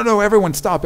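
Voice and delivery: ordinary talk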